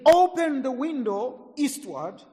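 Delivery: a prophet's order, drawn out; prophet's command. Acted.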